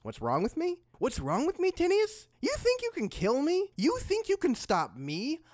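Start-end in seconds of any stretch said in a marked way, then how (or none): 1.0-2.3 high, rising incredulous retort; indignant. Acted. Fighter answering his attacker, indignant. 2.4-5.4 high, rising defiant questions; indignant challenge. Acted. Fighter to would-be killer, indignant challenge.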